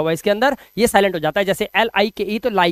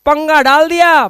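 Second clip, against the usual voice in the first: loud, slow complaint that it's all been messed up; exasperated; 5 semitones higher, 6 dB louder than usual. Real, not acted. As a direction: throw up your hands at the spelling. Exasperated at English spelling. (झुंझलाकर ज़ोर से)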